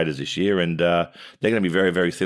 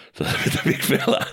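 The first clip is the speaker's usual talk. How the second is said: breathy laugh through the words; 7 semitones higher than usual. Real, not acted. Laughing at the big fella's line.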